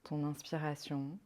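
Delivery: calm, unhurried meditation voice with long pauses; guided meditation. Acted. Yoga guide leading a listener into rest and counted breathing, guided meditation. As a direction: guide them softly into rest, leaving space between phrases.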